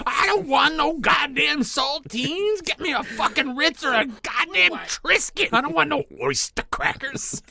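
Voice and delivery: high, loud, slow furious rant; mock ranting parrot. Host ranting as the parrot about crackers.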